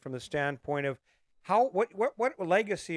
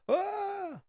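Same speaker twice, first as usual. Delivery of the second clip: a high, put-on "Ah" that brushes it off; mock dismissive gasp. Voicing people who scoff at him, with a high mock gasp. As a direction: play it as the scoffing crowd. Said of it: "a lot of people go".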